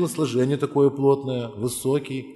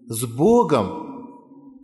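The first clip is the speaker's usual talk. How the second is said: drawn out; 3 dB louder, much slower than usual. Real, not acted. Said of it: "slow emphatic close".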